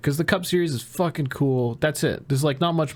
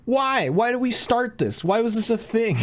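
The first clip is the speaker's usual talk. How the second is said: much higher, incredulous run of questions; exasperated why; 11 semitones higher than usual. Real, not acted. Podcaster baffled by the fan feud, high incredulous questions. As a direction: plead in disbelief at the fan war.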